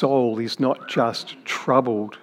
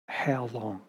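"How long?" dropped much softer, almost spoken to himself; fading echo; 10 dB quieter than usual. Shown as a demonstration. Preacher echoing David's lament to the congregation, trailing off softly. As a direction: let "How long?" fall away into a hush.

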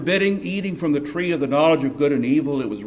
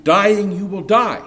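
"Dying you will die" said slow and louder, every word weighted; emphatic literal rendering; 5 semitones higher, 6 dB louder than usual. Shown as a demonstration. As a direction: lean hard on "Dying" and "die", slow and loud. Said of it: "stresses emphasis".

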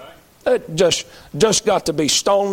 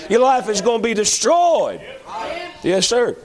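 normal talking voice

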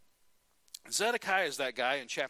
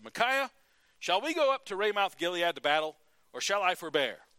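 louder, commanding king; king's demand; 3 semitones higher, 8 dB louder than usual. Retold or imitated. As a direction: boom the question across the court. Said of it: "Ahab said".

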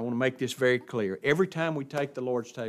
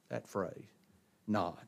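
ordinary talk, nothing marked

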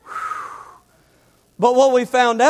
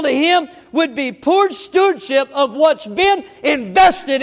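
louder preaching with rising intensity; 5 semitones higher, 5 dB louder than usual. Acted. Pastor urging the church to faithful stewardship, preaching hard. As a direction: drive the point home to the congregation.

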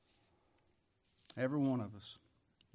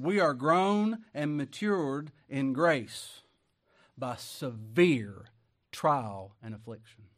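very slow, louder, weighing each word; slow solemn quote; 7 dB louder, much slower than usual. Retold or imitated. Preacher quotes Brother Marvin to the flock, very slow. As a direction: drag it out like a solemn truth. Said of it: "Brother Marvin said this".

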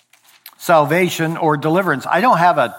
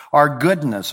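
normal talking voice

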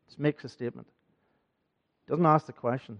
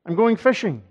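"I'm going fishing" repeated higher and louder, stressing going; emphatic repeat; 8 semitones higher, 8 dB louder than usual. Retold or imitated. Preacher voicing Peter's resolve to the congregation, emphatic. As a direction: hit the words "I'm going fishing" harder and higher the second time.

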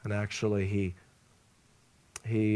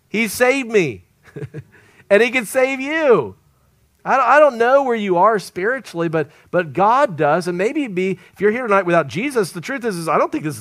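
louder, higher preaching at full force; fervent preaching; 7 semitones higher, 7 dB louder than usual. Acted. Preacher urging the congregation to accept Jesus, fervent and loud. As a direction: drive it home to the congregation.

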